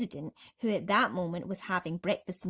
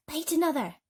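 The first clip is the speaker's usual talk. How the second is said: higher, louder curt order; 8 semitones higher, 4 dB louder than usual. A narrator's reading. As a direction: snap out the command. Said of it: "James ordered".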